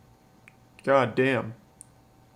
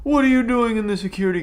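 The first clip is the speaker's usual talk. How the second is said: alarmed shout; 10 semitones higher, 6 dB louder than usual. Real, not acted. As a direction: yell in shock, then slide down in pitch. Jump scare in horror game.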